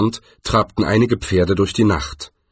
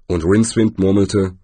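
plain reading voice

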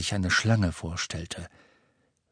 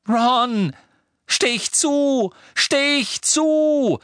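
much higher, loud desperate shout; 6 dB louder, much slower than usual. A narrator's reading. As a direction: scream at him to strike. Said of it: "schrie Harry". (Harry schreit Ron verzweifelt an zuzustechen)

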